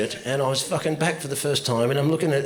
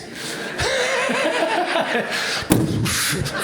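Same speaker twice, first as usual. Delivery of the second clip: shrieking laugh, much higher; high squealing laugh. Real, not acted. Comic cracking up at his own patronising line. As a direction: let a high cackle burst out.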